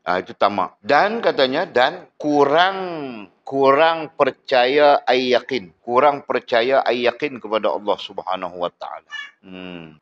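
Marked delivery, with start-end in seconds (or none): 0.8-5.8 slow, deliberate reading of the kitab; teacher spells the text out slowly; slow dictation. Shown as a demonstration. Teacher reads the text slowly to students. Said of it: "teacher reading the text aloud".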